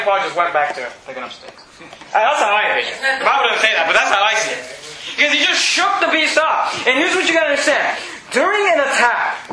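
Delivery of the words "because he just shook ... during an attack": high, emphatic pulpit delivery; forceful preaching. Real, not acted. Preacher explaining Paul's reaction to congregation, forceful preaching. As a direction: drive the point home from the pulpit.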